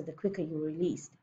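ordinary delivery, nothing marked

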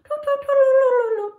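high-pitched imitation flamingo tooting; fluting flamingo toots. Shown as a demonstration. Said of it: "What do you think a fluting flamingo sounds like?".

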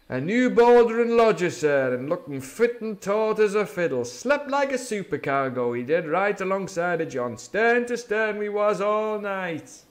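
put-on high, loud, jovial old sea cook; hearty pirate patter; beaming Silver. A narrator's reading. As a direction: chatter on with false cheer. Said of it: "alteration in his voice".

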